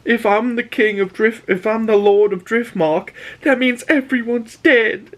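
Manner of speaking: a hint of a tearful boy; boy's voice. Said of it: "he was just like Jon Snow".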